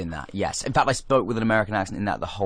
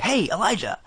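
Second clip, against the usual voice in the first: high-pitched mock greeting; imitated eager greeting; 10 semitones higher than usual. Film producer mistakes actor for Elijah, imitated eager hail. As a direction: call out as an over-familiar acquaintance.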